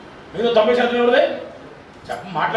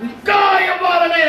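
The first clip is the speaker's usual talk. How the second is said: preaching shout; 9 semitones higher, 3 dB louder, slower than usual. Real, not acted. Pastor climaxes on God's giving.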